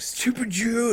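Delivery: high-pitched mimicry of another kid; mocking imitation. Quoting a kid insulting the principal, high mock voice.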